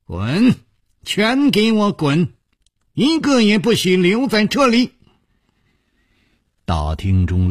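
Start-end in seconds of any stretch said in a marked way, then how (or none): 0.0-4.9 high-pitched, commanding yell; stern shout. A narrator's reading. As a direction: order everyone out in a high, harsh shout. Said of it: "厉声喝道".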